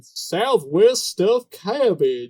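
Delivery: drawn-out, exaggerated Southwest twang; mock Southwest accent. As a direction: imitate the cook's put-on Southwest accent, slowly. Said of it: "that's the accent I'm doing".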